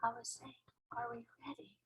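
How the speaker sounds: very soft near-whisper; hushed, careful question; under her breath. A narrator's reading. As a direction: say it barely above a breath. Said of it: "she spoke so softly".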